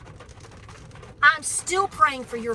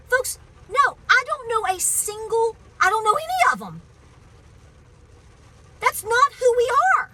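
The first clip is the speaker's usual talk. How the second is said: much higher and louder, heated denial; indignant outburst; 9 semitones higher, 6 dB louder than usual. Real, not acted. To the viewers, defending Trump supporters in a loud heated outburst.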